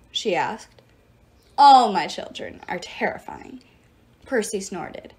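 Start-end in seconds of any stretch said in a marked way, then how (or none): 1.6-3.6 loud, proud goddess; emphatic boast. A narrator's reading. Goddess boasting of her terrifying children, emphatic. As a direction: declare it forcefully with weight.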